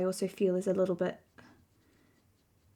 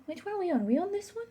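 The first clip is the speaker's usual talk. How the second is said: much higher, rising, unsure; high puzzled question; 9 semitones higher, 4 dB quieter, faster than usual. Real, not acted. Checking which swatch.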